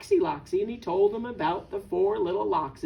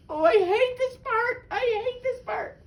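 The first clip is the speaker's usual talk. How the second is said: high-pitched wail of protest; hammed-up high dismay; playful dread; 13 semitones higher than usual. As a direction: wail it high as if unable to face the story. Reader dreads the sad part.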